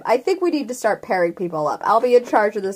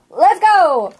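higher, louder eager call; excited retake; 7 semitones higher, 4 dB louder than usual. Shown as a demonstration. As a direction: call it out with more energy. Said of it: "Take two".

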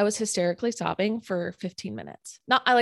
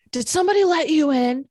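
high, loud shout up the stairwell; retold scream; 8 semitones higher, 7 dB louder than usual. Neighbour yelling up the stairs from the lower level, loud and high. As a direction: yell it up from below. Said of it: "I hear someone from the lower level scream".

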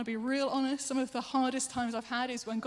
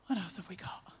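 whispered aside to herself; a quick unvoiced murmur to the room; 4 semitones lower, 6 dB quieter than usual. Real, not acted. Preacher whispering an aside between slides, unvoiced.

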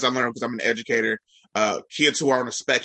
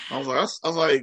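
normal talking voice

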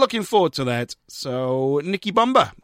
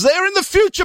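high, loud, excited jokey shout; 5 dB louder than usual. Acted. Radio DJ telling listeners New Zealand is ahead, high excited shout. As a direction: yell it up high like a mock revelation. DJ joking about time zones.

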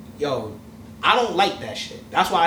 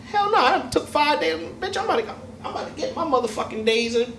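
indignant inner thought; 7 semitones higher than usual. Retold or imitated.

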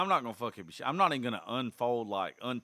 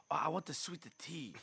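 higher-pitched mock Chinese accent; overplayed Chinese-restaurant accent; 6 semitones higher, 4 dB quieter than usual. Dad ordering at a Chinese restaurant, overplayed mock Chinese accent. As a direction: overdo a bad Chinese accent. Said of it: "like, overplaying it now".

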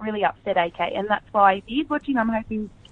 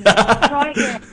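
slow, stretched and louder taunt; milk the joke slowly; drawn-out tease; 3 semitones higher, 5 dB louder, much slower than usual. Pretend.

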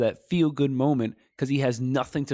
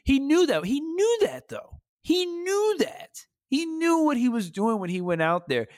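knew said much higher each time, stretched out; emphatic repetition; 13 semitones higher than usual. Real, not acted. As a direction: keep hammering "knew" higher and higher. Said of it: "emphatic repetition".

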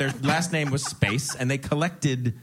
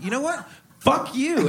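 retold outburst; 11 semitones higher, slower than usual. Arguing with his editor about Jersey, a mock angry outburst. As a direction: fire back at the snob. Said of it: "And I was like".

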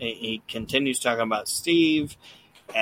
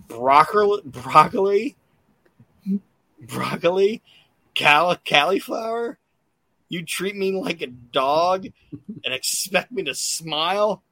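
put-on high, whiny little boy voice, rising at each end; bratty kid; 6 semitones higher than usual. Bald boy at the table whining to his parents, higher kid voice. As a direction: play the spoiled kid complaining at dinner. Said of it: "And he goes".

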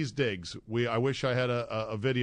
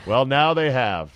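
dry sarcasm; 6 dB louder than usual. Real, not acted. Sarcastic reply about injury.

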